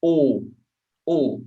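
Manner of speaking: closed O sound, lips rounded; closed vowel. Shown as a demonstration. Portuguese teacher showing the circumflex sound, closed O. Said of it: "a closed vowel sound".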